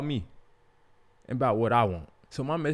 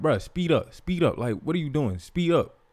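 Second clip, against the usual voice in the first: louder, higher urging to speed up; impatient yelling; 5 semitones higher, 5 dB louder than usual. Retold or imitated. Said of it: "So I'm like".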